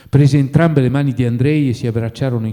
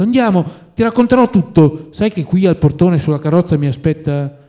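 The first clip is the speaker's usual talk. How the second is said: higher, louder, coaxing him along; excited insistence; eager urging; 7 semitones higher, 5 dB louder than usual. A narrator's reading. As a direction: hurry a friend out the door. Friend urging him to come.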